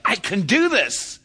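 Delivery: high, loud burst of joy; a girl thrilled her ball cleared the net; excited girl. Retold or imitated. Player cheering her own good serve, high excited cry. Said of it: "they get so excited".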